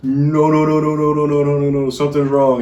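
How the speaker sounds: hammed-up alarm, repeating no fast; mock panic. Husband mimicking his wife in labor, mock panicked and loud.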